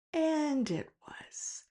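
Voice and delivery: 속삭이듯 낮게 털어놓는 반전; breathy hushed whisper, slow; hushed reveal. Real, not acted. Punchline of the blueberry reveal.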